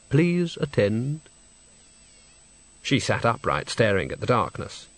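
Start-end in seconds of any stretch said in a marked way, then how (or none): 0.1-1.2 slow, drawn-out polite call; drawn-out summons. Acted. A voice calls Christine to attend, slow summons. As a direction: stretch it out like a formal summons.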